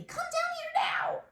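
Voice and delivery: high, loud exclaiming; with feeling. Shown as a demonstration. Teacher showing the class an exclamation, high and loud. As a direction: say it like you mean it, loud. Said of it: "I'm kinda saying it loudly".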